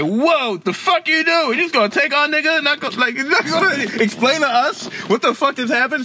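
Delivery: high, incredulous outcry; outraged Haitians. Retold or imitated. Haitians protesting their president being taken, high outraged outcry. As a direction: voice the crowd's shocked anger.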